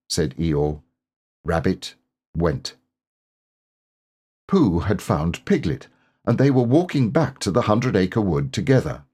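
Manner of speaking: ordinary delivery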